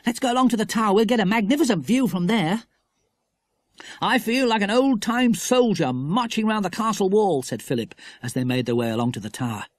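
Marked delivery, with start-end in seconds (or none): 0.1-2.7 put-on higher keen boy voice; eager boy. A narrator's reading. 4.0-7.4 lightly voiced cheerful boy; enjoy the make-believe; playful boy. A narrator's reading.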